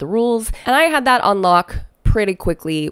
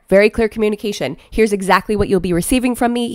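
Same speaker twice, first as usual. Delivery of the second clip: ordinary talk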